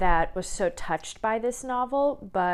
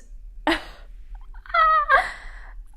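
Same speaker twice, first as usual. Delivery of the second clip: high laugh; 4 dB louder than usual. Real, not acted. Breaks up remembering book.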